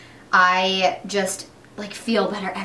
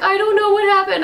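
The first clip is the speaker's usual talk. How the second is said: higher, lightly voiced confused husband; mock Tim; 10 semitones higher, 5 dB louder than usual. Imitating her husband at lunch, baffled and higher. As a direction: play the baffled husband, pitched up. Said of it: "and he was like".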